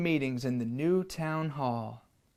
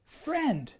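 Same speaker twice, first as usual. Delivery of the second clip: put-on higher, confident boy; the boy answers the lion, higher; 10 semitones higher than usual. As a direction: answer high and sure like a boy. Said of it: "I said".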